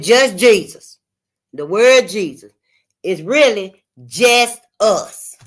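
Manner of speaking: "Jesus" split as "just us", loud and slow; word breakdown. Retold or imitated.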